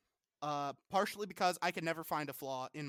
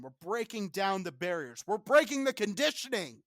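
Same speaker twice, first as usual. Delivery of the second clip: building from raised voice to high, loud shout; rally the crowd, getting louder and higher; rousing shout; 5 semitones higher, 8 dB louder than usual. Acted. Mock rousing speech, building to shouting.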